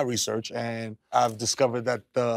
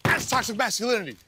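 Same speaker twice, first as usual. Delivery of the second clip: much higher, preachy and self-important; grandiose rant; 10 semitones higher than usual. Acted. Kyrie ranting to the reporters about masculinity, high preachy rant. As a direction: proclaim it like a prophet.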